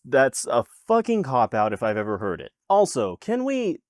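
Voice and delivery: ordinary delivery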